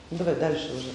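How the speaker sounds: ordinary talk, nothing marked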